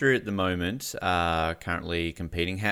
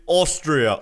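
higher, louder one-word film reply; Dumb and Dumber impression; 6 semitones higher, 6 dB louder than usual. Interviewer quoting Dumb and Dumber's reply, hammed-up voice. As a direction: answer in a put-on movie character voice. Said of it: "reminds you of Dumb and Dumber".

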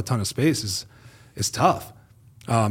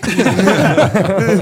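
big laugh; 9 semitones higher, 8 dB louder than usual. Real, not acted. Loud laugh at own story.

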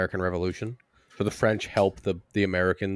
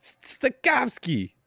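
much higher, very slow, drawn-out tough-cop voice; Samuel L. Jackson; 14 semitones higher, slower than usual. Acted. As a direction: lean hard on each word like a badass police chief. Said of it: "Samuel L. Jackson as the French police chief".

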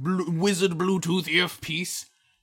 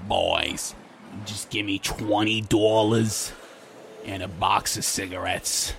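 low, slow sleazy hooker voice; Big Ange; 10 semitones lower, 4 dB quieter than usual. Acted. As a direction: deal it out deep and lewd.

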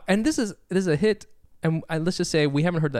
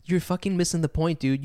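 usual speaking voice

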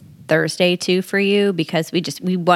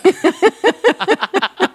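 squealing laugh, much higher; high burst of laughter; 10 semitones higher, 4 dB louder than usual. Real, not acted. Laughing at her co-host's joke about fall balls, high squealing laugh. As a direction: break into a high giggle.

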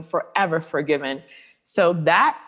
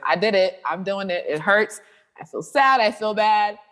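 hurt confession; 4 semitones higher than usual. Retold or imitated. Quoting her own pained prayer.

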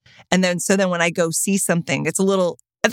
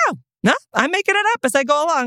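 fast insistent protest; 8 semitones higher, much faster than usual. Retold or imitated. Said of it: "she was like".

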